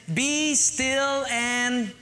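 very slow, stretched out; drawn-out reverent quote; slow scripture reading. Shown as a demonstration. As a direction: let each word settle, slowly. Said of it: "Would you underline "still".

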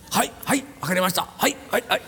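eager Japanese yeses, popped out short; put-on Japanese accent, obedient and abrupt; Japanese guy. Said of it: "the Japanese guy says".

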